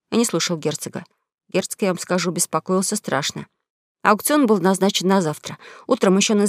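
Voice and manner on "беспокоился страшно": ordinary delivery, nothing marked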